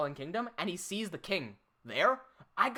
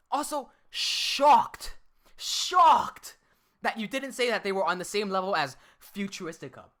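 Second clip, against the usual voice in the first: mocking reread; 5 dB louder than usual. Streamer rereads the rival's comment to mock its wording. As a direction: stretch out "shocked" to ridicule it. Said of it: "the wording he used".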